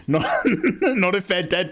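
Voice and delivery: slow words with a laugh through them. Real, not acted. Streamer laughing at a chat claim about plants. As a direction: laugh while you say it.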